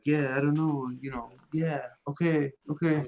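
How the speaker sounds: emo Peter Parker. Acted. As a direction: trail through it like an awkward, moody kid. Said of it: "he was, like, all emo".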